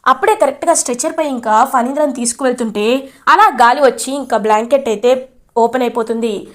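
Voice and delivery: ordinary talk, nothing marked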